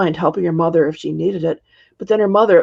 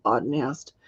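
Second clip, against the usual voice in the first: ordinary delivery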